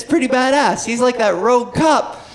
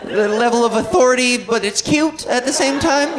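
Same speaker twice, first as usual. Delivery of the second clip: normal talking voice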